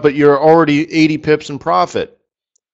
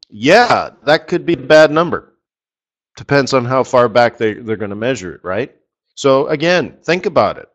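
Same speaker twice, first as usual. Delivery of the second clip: usual speaking voice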